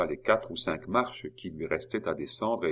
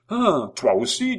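higher, slower, drawn-out startled cry; indignant surprise; 10 semitones higher, 9 dB louder than usual. Acted. Simon finding Maurice against him too, in high slow surprise. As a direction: sound stunned and betrayed.

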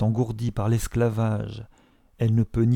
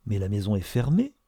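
ordinary delivery, nothing marked

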